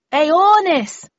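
loud, high-pitched yell; full-voiced calling shout. A narrator's reading. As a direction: call out at the top of your voice. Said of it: "I shouted at the top of my voice".

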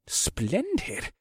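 soft, high, satisfied sigh; contented sigh. A narrator's reading. General pleased with his new pans, soft contented sigh. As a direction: sigh the word softly with contentment. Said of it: "Bakarth said with a contented sigh".

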